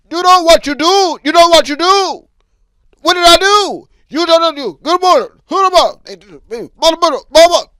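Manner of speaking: loud, high foreign driver yelling; screaming out the window. Pretend. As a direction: yell from the car window in a rage. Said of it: "screaming out the window".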